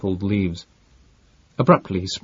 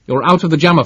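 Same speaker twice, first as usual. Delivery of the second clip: loud, higher urgent yell; 8 semitones higher, 7 dB louder, faster than usual. A narrator's reading. Sherrinford warns Barbro of danger, loud yell. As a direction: shout the danger at her. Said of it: "Sherrinford yelled".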